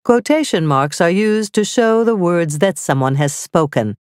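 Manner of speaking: normal talking voice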